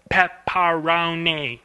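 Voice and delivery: pepperoni with the jaw snapping on every syllable; slow pepperoni, a beat on each syllable; choppy pepperoni. Shown as a demonstration. Showing the animators' wrong way, slow and choppy. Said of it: "jaw open closes on every single syllable".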